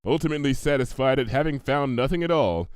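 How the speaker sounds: ordinary delivery, nothing marked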